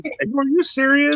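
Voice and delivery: very high, slow disbelief; stunned disbelief. Retold or imitated. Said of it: "I was like".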